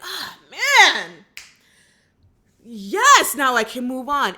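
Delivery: high, loud mock sigh of relief; exaggerated joy at finally getting closure. Mimics people relieved by closure.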